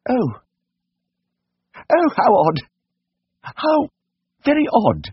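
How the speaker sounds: very high, wondering falsetto; embarrassed lady. A narrator's reading. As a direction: take it in haltingly as a flustered lady.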